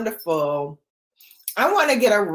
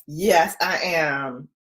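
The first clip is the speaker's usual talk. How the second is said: normal talking voice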